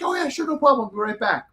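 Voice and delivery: imitating a helpful stewardess in a high voice; obliging stewardess. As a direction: lift the pitch way up and readily agree. Retold obliging stewardess, raised voice.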